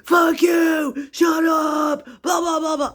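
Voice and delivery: high loud mock shriek at the ceiling; mock screaming. Vlogger imitating Joanna screaming at spirits, high mock yell. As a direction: imitate her yelling at spirits, hammed-up. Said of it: "She will literally start screaming".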